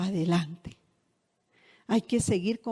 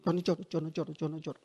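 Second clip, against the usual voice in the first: low, soft muttered denial; mock tearful denial; 5 semitones lower, 8 dB quieter, faster than usual. Imitating a sister who cries and denies it, low mutter. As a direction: mutter it low, fading away. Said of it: "llora y dice".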